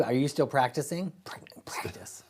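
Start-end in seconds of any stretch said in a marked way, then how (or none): 1.2-2.2 whispering. Pretend. Teasing repeat of practicing.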